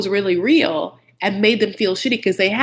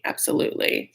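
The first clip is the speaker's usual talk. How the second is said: a whispered echo of the word; soft whispered afterthought; 5 dB quieter than usual. Real, not acted. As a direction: drop it to a whisper the second time. Repeats agreement, second whispered.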